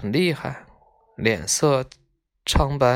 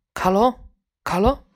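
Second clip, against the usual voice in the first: mild, higher-pitched call of a name; 5 semitones higher than usual. A narrator's reading. Sick boy calling his friend by name, mild calling out. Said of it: "忽然听见小石匠在喊".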